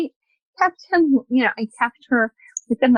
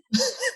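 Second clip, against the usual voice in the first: high, soft giggle; amused laugh; 5 dB quieter than usual. Real, not acted. Storyteller laughing at her doorstep greeting, soft high giggle. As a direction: giggle at your own awkwardness.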